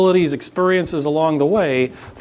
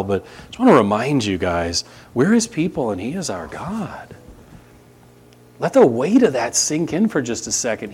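ordinary talk

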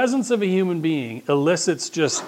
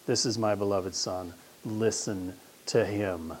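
hushed divine voice; 6 semitones lower, 9 dB quieter, slower than usual. Retold or imitated. Voice of God quoted again.

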